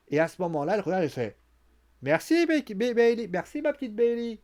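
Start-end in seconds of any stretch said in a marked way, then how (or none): 2.0-4.4 high, sugary mock gratitude; mock-sweet thanks. Becky taunting Bayley, fake-sweet gratitude.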